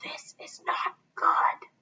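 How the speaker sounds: breathy unvoiced whisper, slow; scared whisper. A narrator's reading. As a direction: hush it like a scared kid. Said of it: "whispered Desmond".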